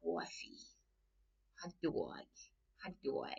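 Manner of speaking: softly savoured Brooklyn vowels; Brooklyn accent. Retold or imitated. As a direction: mimic Brooklyn on single words, softly. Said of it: "Brooklyn accent".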